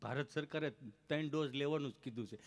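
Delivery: lightly voiced tongue-tied man; mock halting speech; stammering friend. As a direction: imitate a halting talker making his case. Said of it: "જીભ જલાય, ચોંટે અટકે".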